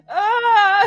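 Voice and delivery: loud high crying wail; sobbing wail. Acted.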